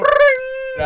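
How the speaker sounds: high loud ring sound; mock phone ring. Host faking the call-in phone ringing, high.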